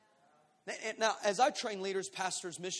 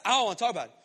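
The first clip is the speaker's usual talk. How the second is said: irritated refusal; 8 dB louder, much faster than usual. Acted. Provoked, refusal getting louder.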